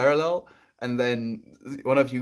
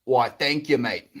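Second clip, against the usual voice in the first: put-on Australian accent; 4 dB louder, slower than usual. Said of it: "I love your Australian accent, mate".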